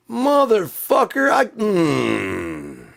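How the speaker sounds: high, drawn-out scream; loud rage cracking into a growl of frustration; furious outburst. Acted. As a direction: explode on the curse. Ranting at the one who quit.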